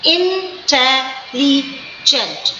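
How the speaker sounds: "intelligent" chanted slowly syllable by syllable; chanted teaching demo of "intelligent"; sing-song syllables. Shown as a demonstration. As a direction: lilt through the four syllables of "intelligent" slowly. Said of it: "four times it is going down".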